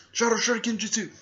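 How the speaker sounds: high, loud battle cry; shouted attack call. Retold or imitated. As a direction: call out the special move at full volume. Character calls out an attack.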